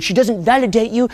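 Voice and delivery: ordinary delivery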